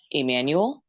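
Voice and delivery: ordinary talk, nothing marked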